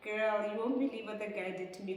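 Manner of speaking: quick, urgent gossip to a friend; rushed disbelief. Retold or imitated. Woman phoning her friend about the guy, rushed disbelief. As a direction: blurt it out in a rush.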